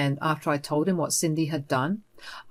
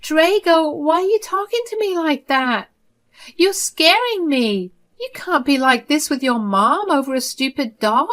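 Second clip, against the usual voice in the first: much higher, whiny pleading mother, hint of crying; mock tearful plea; 10 semitones higher, 4 dB louder than usual. Mother placating her angry son, higher tearful pleading. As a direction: play the wounded mother to win him back. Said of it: "by softly crying and saying".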